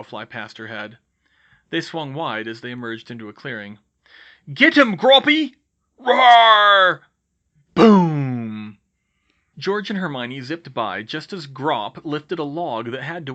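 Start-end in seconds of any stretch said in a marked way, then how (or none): 4.5-5.5 high, loud urging; rousing yell; battle shout. Acted. Giant urged on to attack. 6.0-7.0 long, loud, high-pitched roar; drawn-out bellow; giant's roar. Acted. Giant roars as he attacks. 7.7-8.8 loud, drawn-out boom; booming word that falls away; crashing boom. A narrator's reading. Narrator voices a crash.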